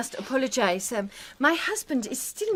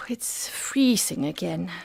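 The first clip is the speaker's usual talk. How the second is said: whisper; 5 semitones lower, slower than usual. Acted. Wife cold, whispering.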